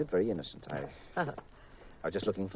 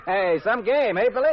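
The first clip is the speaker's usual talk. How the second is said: hearty cheer; 7 semitones higher, 5 dB louder than usual. Acted. Man cheering boy at ballgame.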